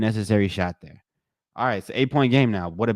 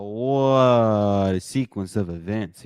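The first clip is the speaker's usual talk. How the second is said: slow, stretched exclamation; slow incredulous commentary; drawn out; much slower than usual. Real, not acted. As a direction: savor every word slowly.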